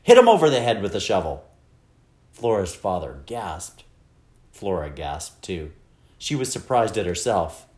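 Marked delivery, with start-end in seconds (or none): 0.0-1.4 loud, impatient command; fed up and emphatic; exasperated order. A narrator's reading. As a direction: lay down the order forcefully. Exasperated mother ordering her husband.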